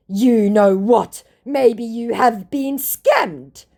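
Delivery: slow, high, loud mock retort; hammed-up accusation; indignant scammer. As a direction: draw it out as an affronted scammer.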